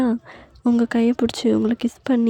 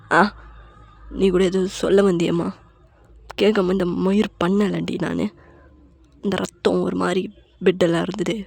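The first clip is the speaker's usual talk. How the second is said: a hint of a lower, heavy husband's voice; lightly voiced grieving husband; 3 semitones lower than usual. A narrator's reading. Husband tells his wife the baby is gone, lower and heavy. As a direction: voice the broken husband confessing, low.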